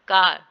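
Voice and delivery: कार said loud, long open aa; pronouncing car. Shown as a demonstration. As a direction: sound out कार so the final R is not heard. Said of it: "जैसे".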